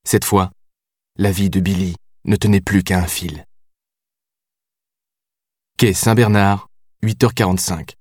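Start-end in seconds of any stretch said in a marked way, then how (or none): none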